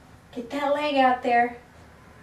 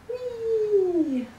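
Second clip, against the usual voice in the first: high playful squeal; playful whee; 11 semitones higher than usual. Real, not acted. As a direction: cheer like playing with a puppy. Bathing dog, playful cheer.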